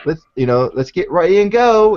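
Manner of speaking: lightly put-on New Zealand accent, rising to a call; mimicking New Zealand accent. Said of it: "in her accent".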